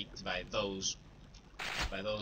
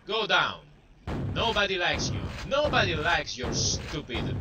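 taunting yell; 6 semitones higher, 5 dB louder than usual. Real, not acted. Yelling insults at game enemy.

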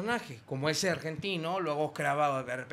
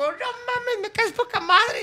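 much higher, thrilled shriek; excited camp squeal; 4 dB louder, slower than usual. Retold or imitated. Drag performer thrilled by the prank caller, squealing. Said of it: "el otro güey se volvió loco".

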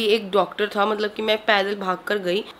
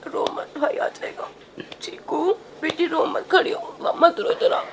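whispered comfort, no voice; soothing whisper; 6 semitones higher, slower than usual. Real, not acted. Mother whispers to her crying son to stop crying, whispered comfort. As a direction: hush him gently in a whisper.

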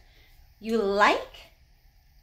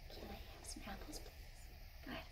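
whisper; 28 dB quieter than usual. Shown as a demonstration.

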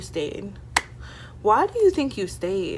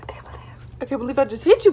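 shocked; 5 semitones higher, much faster than usual. Retold or imitated. Shocked quote.

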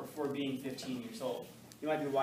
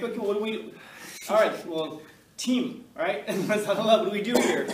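1 mock panic; 6 semitones higher, 8 dB louder than usual. Teacher panicking, huddling class.

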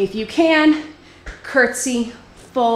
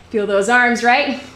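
usual speaking voice

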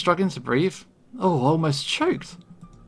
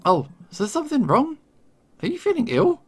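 high, falsely innocent questions; mock concern; 10 semitones higher than usual. Poisoner to his choking victim, mock concern. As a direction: feign sweet worry, pitched way up.

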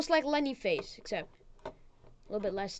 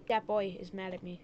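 ordinary talk, nothing marked

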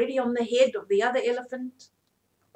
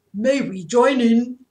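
elephant voice; 11 dB louder than usual. A narrator's reading. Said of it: "said another".

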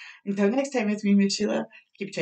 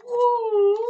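excited woo; 11 semitones higher, 6 dB louder than usual. Acted. Excited dive in the story.

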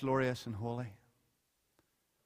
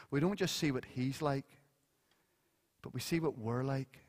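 ordinary talk, nothing marked

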